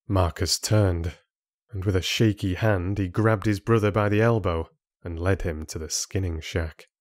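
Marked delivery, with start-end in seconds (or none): none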